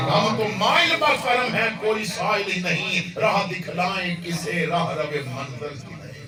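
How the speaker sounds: recited verse. Acted.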